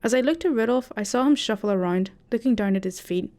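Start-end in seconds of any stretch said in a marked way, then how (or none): none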